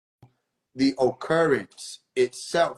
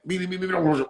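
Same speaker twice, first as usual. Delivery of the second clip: rewind beeps; 5 semitones higher than usual. Pretend. Rewinding the tape, run it back.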